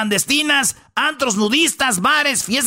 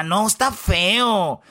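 imitando a la mamá; 3 semitones lower, much slower than usual. Retold or imitated. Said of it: "y dice la mamá".

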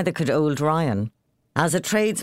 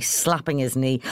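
ordinary delivery, nothing marked

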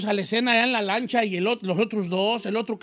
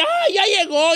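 excited wife; 11 semitones higher, 6 dB louder, slower than usual. Acted. Wife sees husband return.

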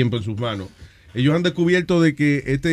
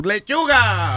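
big reveal; 6 semitones higher, much slower than usual. Pretend. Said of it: "Adivinen qué tenemos".

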